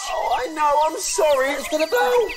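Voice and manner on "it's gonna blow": panicky. Acted. Machine about to blow.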